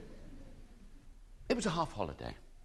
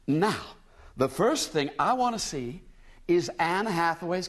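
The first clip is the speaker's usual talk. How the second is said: high, chatty American woman; bright tourist asking a boy; eager American lady; 7 semitones higher than usual. A narrator's reading.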